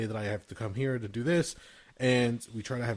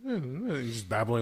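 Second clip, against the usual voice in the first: normal talking voice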